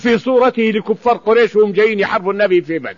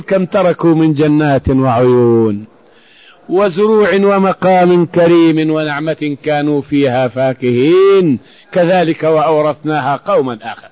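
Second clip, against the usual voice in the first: slow, lower chanted recitation; Quran recitation; 5 semitones lower, slower than usual. Acted. Preacher recites verse to congregation, slow chant. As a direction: intone the verse slowly, reverently.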